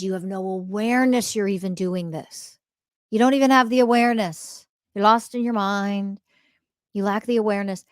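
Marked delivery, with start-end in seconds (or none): none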